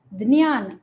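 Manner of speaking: ज्ञान said loud as "d-ni-yaan"; Marathi-style dnyan. Shown as a demonstration. Teacher imitates Marathi speakers, ज्ञान as "dniyaan". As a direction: put a full d before "niyaan" in ज्ञान. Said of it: "कुछ मराठी भाषी प्रायः वो".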